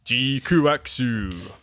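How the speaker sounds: lightly dramatic movie announcer; a hint of a vintage Japanese trailer; old-timey movie voice. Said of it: "my Japanese, like, old-timey movie voice".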